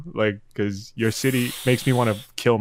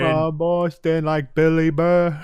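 slow, a bit higher hint of Boston; mock Boston accent; 5 semitones higher, 4 dB louder, slower than usual. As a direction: lightly voice a Boston guy. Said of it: "A little Boston".

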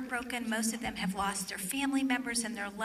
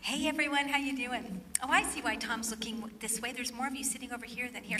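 ordinary delivery

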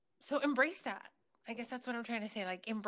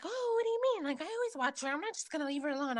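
higher, mildly offended mom voice; defensive mom; 7 semitones higher, much faster than usual. Retold or imitated. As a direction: brush it off like a slighted mother. Quoting mom brushing off warning.

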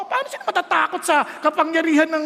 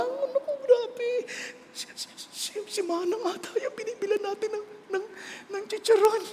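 high, hushed, halting squeal; mock crying out; 7 semitones higher, 7 dB quieter than usual. Preacher playing someone indoors hearing screams outside, stifled high cry. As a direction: stammer it high and small, holding back a scream. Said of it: "Sisigawan na".

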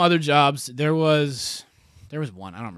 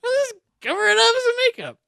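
much higher, breezy put-on voice; mock recruiter; 6 dB louder than usual. Mocking the recruiter who said to hide the tattoo, airy high voice. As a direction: ham up the recruiter brushing it off.

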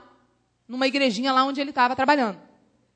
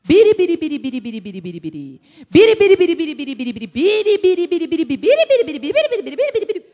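imitated tongues-praying pastor; 2 semitones higher, 4 dB louder, faster than usual. Imitating the man's pastor praying in tongues, loud rapid babble. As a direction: rattle off mock tongues like a zealous pastor. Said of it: "o pastor dele orava assim".

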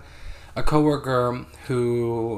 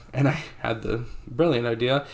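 ordinary talk, nothing marked

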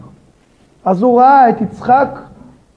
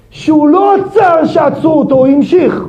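louder, higher preaching emphasis; emphatic shout; 6 semitones higher, 4 dB louder than usual. Real, not acted. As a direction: drive the point home.

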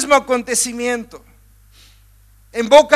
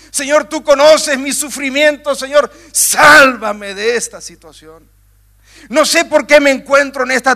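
pleading prayer; 2 semitones higher, 3 dB louder than usual. Acted. Preacher voicing a believer's prayer, loud pleading cry. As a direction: beg the Lord for rescue, voice raised.

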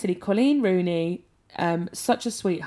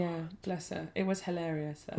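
softer whisper of pity; 9 dB quieter than usual. Real, not acted. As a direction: whisper it with sympathy. Hushed aside about friend's mishap.

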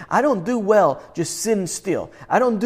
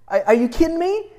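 incredulous; 11 semitones higher than usual. Real, not acted. Preacher reacting to scripture verse.

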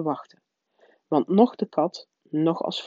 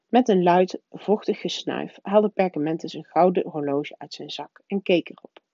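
usual speaking voice

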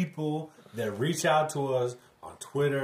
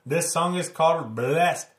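put-on playful Jamaican accent, higher and louder; 5 semitones higher, 5 dB louder, slower than usual. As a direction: put on a Jamaican accent, rude boy style. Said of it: "I'm talking in my Jamaican accent".

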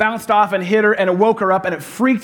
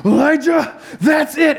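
angry little sister; 7 semitones higher, slower than usual. Retold or imitated. Pastor voices his daughter yelling at her brother, high mock shout. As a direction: shout it like a fed-up six-year-old.

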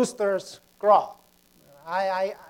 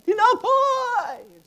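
high, long-drawn vendor call; imitated street cry with a stretched ending; bread seller's cry; 13 semitones higher, 3 dB louder, much slower than usual. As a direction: sing out like a hawker at the gate. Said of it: "the guy will go in, in front of our gate".

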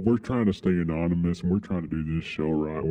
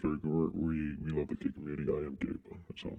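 hushed plea; 4 semitones lower, 12 dB quieter than usual. Real, not acted. Pleading softly, nearly whispered.

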